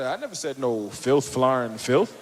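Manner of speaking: very low, innocent denial; deep put-on innocent voice. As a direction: play dumb in a deep voice. Denying, protesting.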